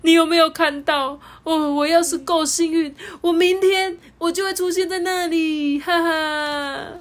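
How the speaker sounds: high, laughing through tears; overjoyed, voice near tears; joyful, a little weepy; tearful joy. Acted. Hanji overjoyed, crying.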